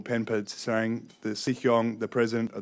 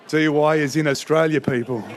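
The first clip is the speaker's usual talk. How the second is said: louder, higher, playfully exasperated; a mock cry of disbelief; amused exasperation; 5 semitones higher, 7 dB louder than usual. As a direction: throw up your hands, laughing at the mix-up. Mock retort to confused refugees.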